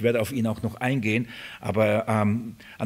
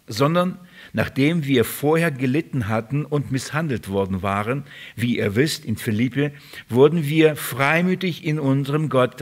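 raised, emphatic Bible-reading voice; declaimed verse with weighty stresses; scripture reading; 3 semitones higher, 3 dB louder than usual. Acted. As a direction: read the passage out to the congregation. Reads Bible passage aloud.

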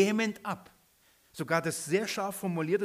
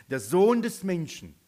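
"Der Sohn des Menschen" with "Sohn" stressed and louder, falling; emphatic; 6 dB louder than usual. Shown as a demonstration. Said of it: "Achtet mal mit mir".

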